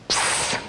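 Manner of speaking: sharp whispered "psst", done twice; secretive attention-getting psst; imitated psst. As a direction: hiss a furtive psst for attention. He calls her again in class.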